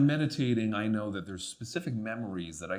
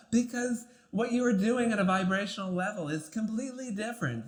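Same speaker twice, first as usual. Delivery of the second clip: higher, sibilant joyous voice held throughout; 8 semitones higher than usual. Shown as a demonstration. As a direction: keep it lifted and free, like pure joy.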